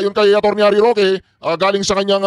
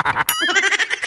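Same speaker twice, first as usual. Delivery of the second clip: mocking laugh; 9 semitones higher, 4 dB quieter than usual. Real, not acted. Laughing at the meet and greet.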